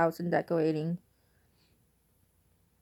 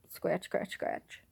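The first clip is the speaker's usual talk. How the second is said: softer, fading mock scratching words; quiet scratch sound; 10 dB quieter, much slower than usual. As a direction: mouth the scratching softly. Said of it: "she would go like".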